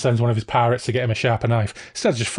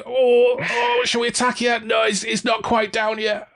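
dithering pirates; 11 semitones higher than usual. Retold or imitated. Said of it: "It was like".

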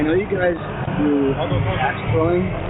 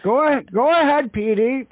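put-on higher-pitched elderly grandpa voice; old man voice; 5 semitones higher than usual. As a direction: play a proud old war veteran. Prank caller plays old veteran.

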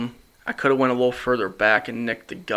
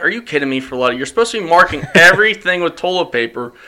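louder, higher and laughing in disbelief; higher, louder mock outrage at Friletta; exasperated disbelief; 5 semitones higher, 6 dB louder, faster than usual. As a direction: scold a friend who messed up, half laughing. Exasperated at buddy's mistake.

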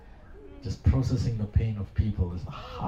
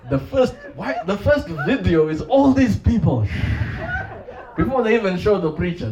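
amused mockery; 10 semitones higher, 6 dB louder than usual. Real, not acted. Mocking TV preacher broadcasts.

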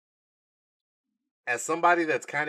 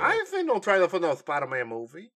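mock clueless moviegoer; 6 semitones higher, 5 dB louder, faster than usual.

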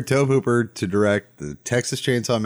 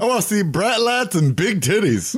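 rowdy shout; 6 semitones higher than usual. Retold or imitated. Caller's line quoted on radio.

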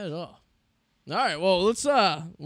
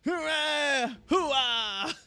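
Al Pacino impression; 10 semitones higher, 4 dB louder, much slower than usual. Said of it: "what's Al Pacino say".

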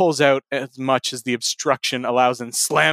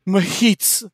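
higher, slurred mumble; mumbled; 6 semitones higher than usual. A narrator's reading. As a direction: run the word together as a mumble. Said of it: "the hobbit mumbles".

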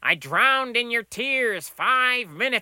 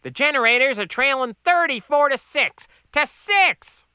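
high, loud cook voice; exasperated yelling; 8 dB louder than usual. Acted. Cook bemoans game to customer, high yell. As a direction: wail the score loudly.

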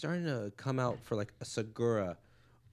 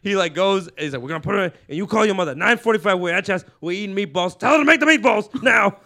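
loud, high, laughing Diaz bellow; comic bellowing Diaz's alibi to the crowd; mock loud rant; 7 semitones higher, 12 dB louder than usual. Acted Diaz riff.

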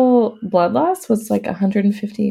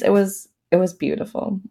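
ordinary talk

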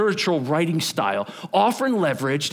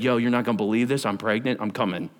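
mock text message; 7 semitones lower, faster than usual. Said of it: "Mary didn't text Elizabeth and said".

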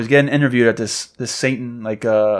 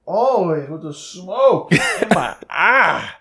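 mock crying; 6 semitones higher, much slower than usual. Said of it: "mock crying reporter".

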